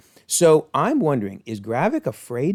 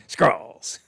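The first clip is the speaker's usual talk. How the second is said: stiff upper lip. Retold or imitated. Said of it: "you gotta do that upper lip".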